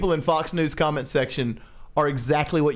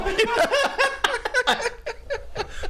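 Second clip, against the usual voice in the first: high burst of laughter. Real, not acted. Laughing at his own fake Fox News comment with a high cackle. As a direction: break into a high, helpless laugh.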